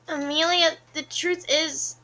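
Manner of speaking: hesitant confession. A narrator's reading. Caleb nervously starts confession.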